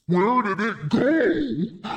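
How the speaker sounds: high, slow wail of disbelief; drawn-out baffled wail; bewildered outcry. Real, not acted. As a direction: cry it out high, stretching the last word. Searching for lost voice setting.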